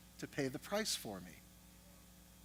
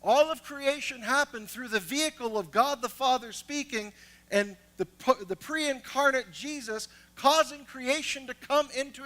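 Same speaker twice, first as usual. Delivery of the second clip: loud, higher preacher voice; full-force preaching; 8 semitones higher, 10 dB louder than usual. Acted. Preacher exhorting congregation at full force. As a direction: preach it, building energy.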